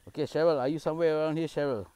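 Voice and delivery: loud call to an unseen presence. Real, not acted. Calling out to a spirit named Cheryl.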